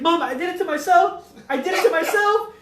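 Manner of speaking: high, loud mock plea; pleading confession. Son confesses to stop mom, urgent pleading. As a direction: imitate a son desperately owning up.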